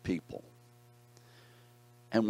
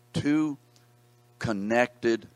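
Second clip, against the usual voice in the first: two connected, each word punched higher and louder; emphatic stress; 5 semitones higher, 5 dB louder, much slower than usual. Shown as a demonstration. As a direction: lean on "two" and "connected" as separate beats. Teacher stresses the key words.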